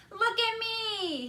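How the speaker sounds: slow, high, loud call; running shout. A narrator's reading. Boy racing to the camera, shouting high. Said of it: "he shouted as he ran".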